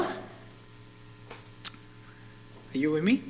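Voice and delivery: ordinary talk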